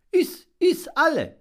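very high, loud urging; generous dove. Acted. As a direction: press him kindly to eat. Dove urges him to eat.

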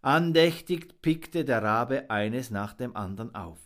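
ordinary delivery

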